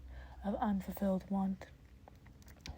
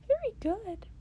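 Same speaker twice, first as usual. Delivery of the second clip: much higher, louder delighted praise; excited praise; 12 semitones higher, 5 dB louder than usual. Acted. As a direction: cheer him on like an excited mentor. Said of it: "Nezu clapped excitedly".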